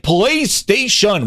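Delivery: "PlayStation" very slow and high, every syllable drawn out; Satya, incredible diction. Acted. Mimicking the CEO saying "PlayStation", slow and grandly enunciated. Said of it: "with incredible dialect".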